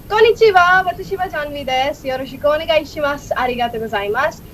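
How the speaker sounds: high greeting, then quick Japanese. Shown as a demonstration. Guest demonstrating languages for the host, speaking Japanese. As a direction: introduce yourself politely in Japanese. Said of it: "And Japanese".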